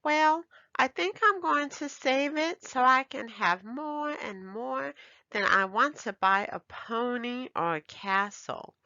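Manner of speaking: put-on high, eager little girl; excited exclaim. A narrator's reading. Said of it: "Ebony exclaimed".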